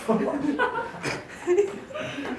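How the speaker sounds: reader breaks into a laugh; a quick laugh at tripping over the word; real laugh. Real, not acted. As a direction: genuine amused laugh. Reader laughs at own slip.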